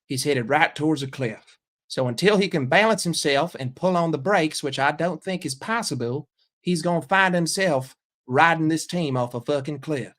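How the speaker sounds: put-on Southern pundit; Southern accent. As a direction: give the verdict in a folksy Southern accent. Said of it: "Southern accent".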